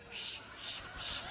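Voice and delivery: whispered mock hush; mock shushing. To the rally crowd, a whispered playful shh. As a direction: hush the crowd as if sharing a secret.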